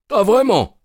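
high, loud bitter retort; ah vraiment, amèrement; bitter exclamation. A narrator's reading. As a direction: snap back, stung. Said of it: "S'exclama Lestrade avec amertume".

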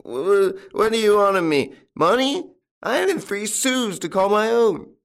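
very high, loud stammering whine; wailing old tramp protesting innocence; whining. A narrator's reading. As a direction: whine pitifully as a cunning beggar. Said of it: "he kept on whining".

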